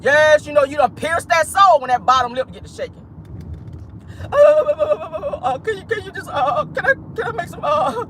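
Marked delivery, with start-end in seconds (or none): 4.2-8.0 high, wobbling fake blubber. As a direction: stammer and plead through hammed-up sobs. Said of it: "That was her ass on live".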